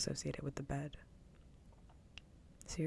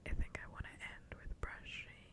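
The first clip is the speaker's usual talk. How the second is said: ASMR whisper; 12 dB quieter than usual. Real, not acted. Whispers plans for ASMR triggers.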